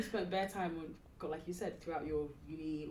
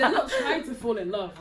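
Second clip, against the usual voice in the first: laughing; 4 semitones higher, 10 dB louder than usual. Real, not acted. Laughing mid-sentence while chatting.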